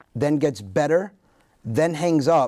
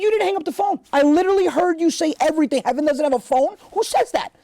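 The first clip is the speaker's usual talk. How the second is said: exasperated son; 4 dB louder than usual. Retold or imitated. Exasperated son confronting dad.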